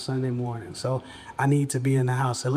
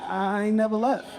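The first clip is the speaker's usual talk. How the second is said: mock protest; 7 semitones higher than usual. Answering the church mothers, in a high playful protest. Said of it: "And I'd be like".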